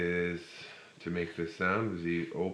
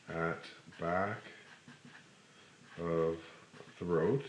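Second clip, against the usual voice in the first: normal talking voice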